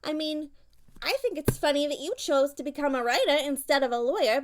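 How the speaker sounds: ordinary delivery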